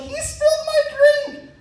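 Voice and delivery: put-on very high, slow whiny girl voice. Girl complaining to the party, very high slow whine. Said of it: "And she's like".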